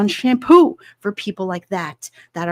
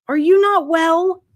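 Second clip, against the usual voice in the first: mock concern; 8 semitones higher, 5 dB louder than usual. Mocking people eating couches.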